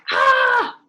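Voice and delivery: high, loud, panicked cry; panicked shout. Acted. Character panics about swallowing the seed, high panicked shout. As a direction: yell it out like a terrified kid. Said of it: "Well, he does".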